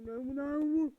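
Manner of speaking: loud, panicked no's; panicked yelling. Real, not acted. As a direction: yell in alarm. Panic at the end.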